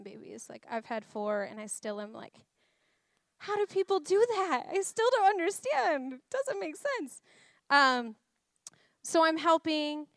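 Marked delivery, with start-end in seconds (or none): 3.4-7.2 high-pitched incredulous outburst; baffled. Retold or imitated. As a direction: marvel in high, comic disbelief. Acts out bafflement at childbirth.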